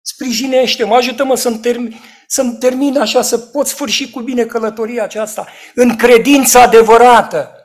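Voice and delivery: loud, high pleading prayer; a preacher's loud retold prayer; fervent preached prayer. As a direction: beg God aloud from the pulpit.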